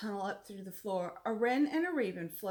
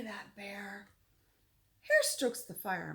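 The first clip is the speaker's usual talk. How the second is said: plain reading voice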